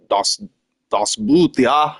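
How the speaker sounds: a hint of German on das Boot; mock German accent. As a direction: lightly voice a cartoon German, German accent. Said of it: "maybe I'm German".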